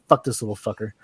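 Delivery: low, quiet muttered aside; inner thought. Acted. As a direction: say it under your breath. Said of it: "In his mind, he's saying".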